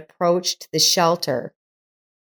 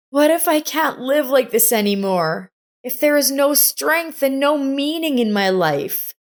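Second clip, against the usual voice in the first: higher, lightly voiced young girl in despair; 8 semitones higher than usual. A narrator's reading. Girl confesses her hopelessness to Miss Meyer, higher despairing voice. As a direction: let the hopelessness spill out.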